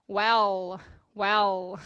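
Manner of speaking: "well" closed with a full L, tongue behind the upper teeth; clear final L. Shown as a demonstration. Teacher models "well" with a full final L. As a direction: land the L at the end of "well". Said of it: "colocar a língua na posição do L".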